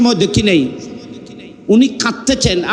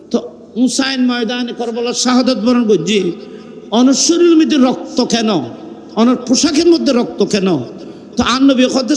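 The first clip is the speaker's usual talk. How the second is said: ordinary delivery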